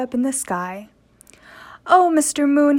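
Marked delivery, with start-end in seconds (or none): 1.9-2.8 louder call up to the sky. A narrator's reading. Willie calls up to the moon above, louder. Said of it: "he cried".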